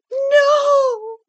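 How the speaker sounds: very high, loud, drawn-out wail; piercing cry of refusal; shriek. A narrator's reading. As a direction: shriek it in desperation. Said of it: "she shrieked".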